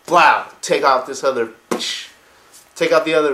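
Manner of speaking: loud, punchy battle cry; big loud "Blow!"; excited outburst. Real, not acted. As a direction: bark it like an explosion. Battle action exclamation.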